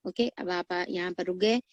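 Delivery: ordinary talk, nothing marked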